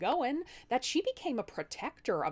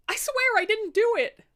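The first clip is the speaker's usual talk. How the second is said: high, loud frantic denial; panicked plea; 13 semitones higher, 9 dB louder than usual. Acted. Husband pleading innocence to his wife, high and panicked. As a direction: beg them to believe you.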